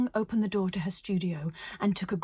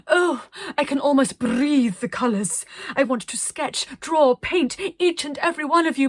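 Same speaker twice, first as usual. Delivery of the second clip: louder, higher gushing; rapturous artist; 6 semitones higher, 7 dB louder than usual. Acted. Arcadia tells the children, louder and gushing. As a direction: gush over them.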